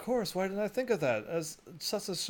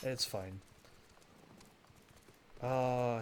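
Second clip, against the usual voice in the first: ordinary talk, nothing marked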